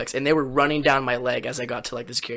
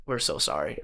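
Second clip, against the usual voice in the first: meek apology; 12 semitones lower, 10 dB quieter than usual. Retold or imitated. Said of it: "they were like".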